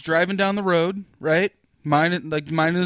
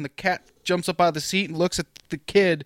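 normal talking voice

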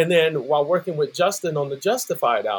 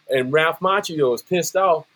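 ordinary delivery

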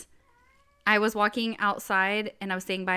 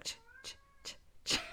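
voiceless ch ch rattling sounds; whispered imitation of a rattle; Tic Tac rattle; 15 dB quieter, faster than usual. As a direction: imitate Tic Tacs shaking in a pocket. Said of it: "So he's like".

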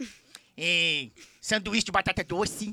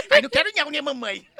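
high laughing words; giggling refusal; 7 semitones higher, 5 dB louder, faster than usual. Real, not acted. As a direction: laugh through the words, much higher. Laughing after singing parody.

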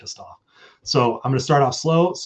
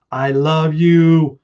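loud, drawn-out declaration to the crowd; stretched, full-volume affection; big loving sign-off; 4 semitones higher, 11 dB louder, much slower than usual. Real, not acted. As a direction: belt it out slowly, higher and louder. Signing off to the audience.

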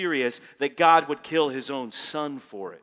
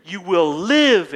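loud promise; 10 dB louder, slower than usual. Acted. Jesus quote at peak volume.

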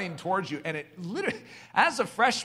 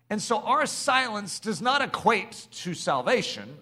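ordinary talk

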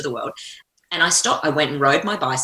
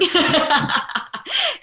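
big laugh. Real, not acted. Host laughing at her own keynote, bursting laughter.

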